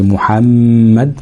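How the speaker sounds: محمد with a long hum on the middle م; held ghunna. Shown as a demonstration. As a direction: linger on the doubled م in محمد. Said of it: "أو على الميم المشددة". (محمد بغنة ممدودة على الميم)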